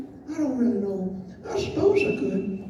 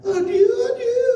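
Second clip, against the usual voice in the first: mock tearful goodbye; 8 semitones higher, 5 dB louder than usual.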